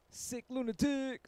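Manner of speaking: high, stretched-out name call; drawn-out shout-out. Real, not acted. Streamer hailing a viewer in chat, high drawn-out shout-out.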